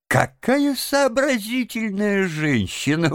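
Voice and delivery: high, fond exclamation; touched admiration. A narrator's reading. Gentleman admires the washerwoman's idea, touched and fond. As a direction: coo it in a high voice, quite charmed. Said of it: "умилился джентльмен".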